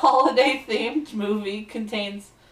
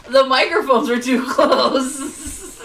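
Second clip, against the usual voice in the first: slow words through laughter; laughing; 4 semitones higher, 8 dB louder, slower than usual. Real, not acted. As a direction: laugh it out slowly.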